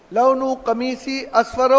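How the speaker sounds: usual speaking voice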